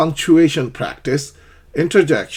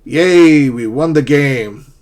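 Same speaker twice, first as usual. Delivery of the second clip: much louder yay, then slower triumphant words; excited cheer; 3 semitones higher, 10 dB louder, much slower than usual. Shown as a demonstration. Teacher acting out a team win for learners, loud and cheering. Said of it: "Interjections in dialogue".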